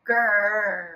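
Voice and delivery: low rumbling growl. Shown as a demonstration. Teacher showing kids a growl. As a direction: growl like a bear. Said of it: "how you could make a growling sound".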